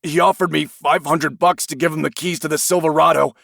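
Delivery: high, pleading confession; confess, scared; frightened confession. Acted. Suspect confesses in fear.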